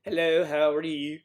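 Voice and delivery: loud, hammed-up European-accented greeting; mock European accent. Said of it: "I talk like that everywhere I go if I'm in Europe".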